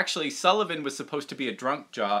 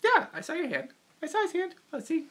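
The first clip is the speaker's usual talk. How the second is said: puppet voice; faster than usual. Acted. Puppet dog voice to chat.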